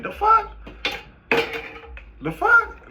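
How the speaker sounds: high, squeaky "the fuck?"; incredulous outburst. Pretend. Reacting in disbelief to someone wanting to pick his brain. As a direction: sputter in disbelief.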